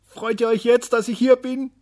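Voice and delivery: high, loud, eager asking; excited question. A narrator's reading. Little day eagerly asking the people, high and loud. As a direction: ask it bursting with joy.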